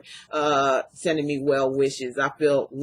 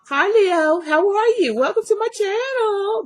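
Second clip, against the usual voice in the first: excited greeting; 4 dB louder than usual. Real, not acted. Cheerful greeting to the viewer.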